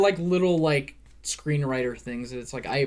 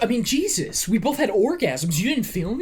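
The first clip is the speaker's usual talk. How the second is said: higher-pitched mock indignant retort; mock indignation; 7 semitones higher, 3 dB louder than usual. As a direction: play it exasperated and incredulous. Acting out the film character's line.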